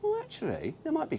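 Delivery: high, hedging reply; mock hesitant. Fan answering himself, high mock hedge. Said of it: "you look and you go".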